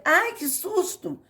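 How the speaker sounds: high, slow startled "Ai, que susto!"; exclaiming. Shown as a demonstration. Teacher demonstrating an exclamation, mock startled. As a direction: act out a fright, pitch jumping up. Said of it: "estou exclamando".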